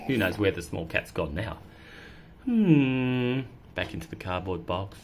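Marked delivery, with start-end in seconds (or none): none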